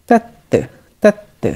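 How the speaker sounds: তাত্তে with a joined double ত, pitched high; doubled ত. Shown as a demonstration. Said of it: "উচ্চারণ হবে তয় তয় যুক্ত". (তাত্তে, ত-য়ে ত যুক্ত করে উঁচু সুরে)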